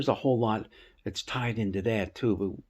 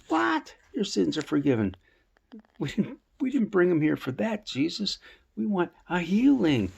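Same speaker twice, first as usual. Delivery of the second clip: much higher, indignant disbelief; incredulous protest; 8 semitones higher than usual. Retold or imitated. Friends objecting to Jesus that they wanted healing, incredulous. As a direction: shoot it up in startled protest.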